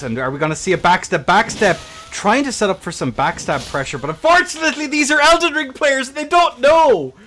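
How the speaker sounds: hyped play-by-play. Acted. Caster calling the duel to his stream, loud and hyped. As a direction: call the action like a big final.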